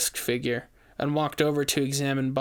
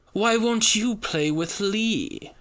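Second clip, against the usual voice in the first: high, slow sugary taunt; sickly sweet witch; 7 semitones higher, slower than usual. A narrator's reading. Witch calling down the hall, slow sickly sweet taunt. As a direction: coo it like a creepy child's game. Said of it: "That sickling sweet voice".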